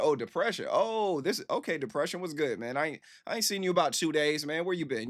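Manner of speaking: playfully acted catching up with a pal; friendly greeting. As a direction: greet it like an old buddy turning up. Greets Depression as an old friend.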